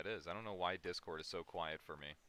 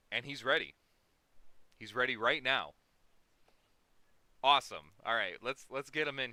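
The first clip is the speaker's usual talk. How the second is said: excited; 4 semitones higher, 11 dB louder than usual. Real, not acted. Guest ready, excited host.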